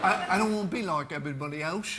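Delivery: Quadrophenia line. Acted. Director finally quotes his favourite film line in Cockney. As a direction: deliver the Quadrophenia line in character. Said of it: "one line from, uh, Quadrophenia".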